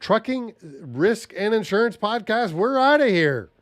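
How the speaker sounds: high, punched-out show title; announcer sign-off. Real, not acted. Host signing off the Trucking Risk and Insurance Podcast, high announcer voice. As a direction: belt out the podcast's closing tag.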